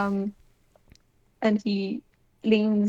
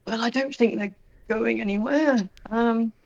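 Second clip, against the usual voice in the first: a touch higher, light Lau voice; Lau in character; 4 semitones higher than usual. Acted. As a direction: answer kindly, a little distracted.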